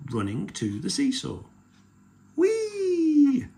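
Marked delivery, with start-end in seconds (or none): 2.4-3.5 very high, loud drawn-out squeal; long shrieky whoop of delight; gleeful cry. A narrator's reading. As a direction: squeal like a toddler whizzing down a slide. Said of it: "cried George".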